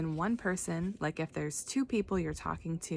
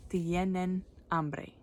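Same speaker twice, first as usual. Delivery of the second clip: slow careful Spanish; slower than usual. Shown as a demonstration. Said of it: "when you're talking to more than one person, it's".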